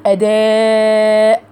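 أداء with the aa stretched four to five counts; مد متصل أربع حركات; long madd. Shown as a demonstration. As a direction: hold the long vowel of أداء before the hamza. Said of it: "مد أربع أو خمس حركات".

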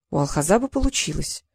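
ordinary delivery

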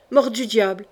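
usual speaking voice

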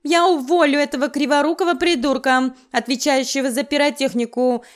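frantic organiser fussing over the bride; loud fussing. Acted. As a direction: fuss and wail about it loudly. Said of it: "загласил".

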